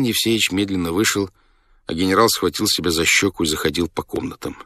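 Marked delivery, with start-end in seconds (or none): none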